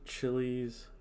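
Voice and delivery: normal talking voice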